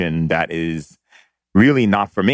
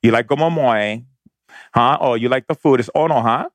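easy, higher Hawaiian pidgin banter; 4 semitones higher than usual. Retold or imitated. Aunts and uncles chatting at home in Hawaiian pidgin. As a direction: chat like family in Hawaiian pidgin. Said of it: "where we are".

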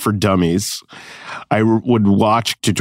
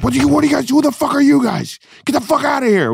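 much higher, hammed-up angry hipster yelling at intruders; angry hipster guy; faster than usual. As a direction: play a furious hipster kicking them out. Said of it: "hipster guy would come in and be like".

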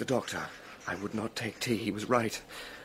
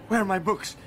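ordinary talk

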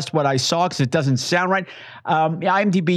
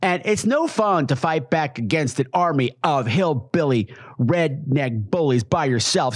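ordinary delivery

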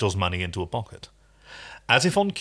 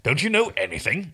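impatient; 6 semitones higher than usual. Acted. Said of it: "Rigel grew impatient".

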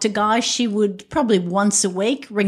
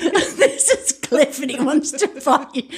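laughing retelling; 7 semitones higher than usual. Retold or imitated. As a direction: barely hold back the giggles. Repeats retold taunt while laughing.

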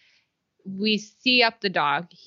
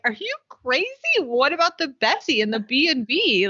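high-pitched, acted-out disbelief; mock incredulous; 6 semitones higher than usual. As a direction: object in imitated astonishment, pitched up. Acting out Pacey's incredulous objection.

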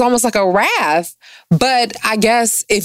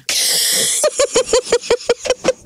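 laugh. Real, not acted. Laughs over cookie.

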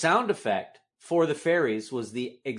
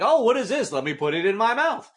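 higher, louder mock toddler voice; curious toddler; 8 semitones higher, 4 dB louder than usual. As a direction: imitate a toddler delighted by a new find.

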